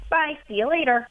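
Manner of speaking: breezy, no-fuss see-you-later; light, offhand farewell; cool, casual goodbye. Shown as a demonstration. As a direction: toss off a goodbye like it's nothing. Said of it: "you're cool as a cucumber".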